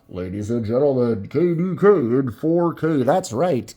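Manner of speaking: booming announcer intro; radio announcer voice. Pretend. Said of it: "like a radio announcer?".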